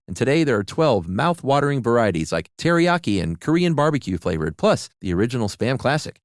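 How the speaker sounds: upbeat commercial announcer voice; ad read. Acted. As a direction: sell it like a cheery radio ad. Host reads Spam ad.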